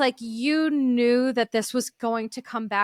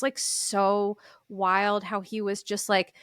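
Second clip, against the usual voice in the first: usual speaking voice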